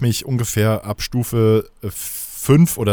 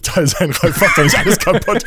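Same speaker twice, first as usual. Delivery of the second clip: laughing, louder, higher; laughing complaint; 5 semitones higher, 5 dB louder than usual. Real, not acted. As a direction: scold him through laughter. (lachend und lauter zum Freund, der die Geschichte stört)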